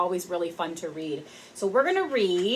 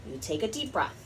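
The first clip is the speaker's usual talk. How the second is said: calm mom voice; 3 semitones lower, 7 dB quieter, faster than usual. Acted. Mom's calm advice in story.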